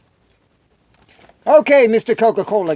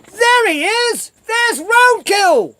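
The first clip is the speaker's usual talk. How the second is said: very high, drawn-out hollered greeting; mock calling out; 12 semitones higher, much slower than usual. Coworkers spot him back at the gas station, mock calling out. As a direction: holler it slowly across a room, stretching each word.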